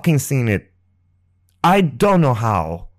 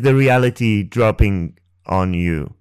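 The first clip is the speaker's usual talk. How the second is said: usual speaking voice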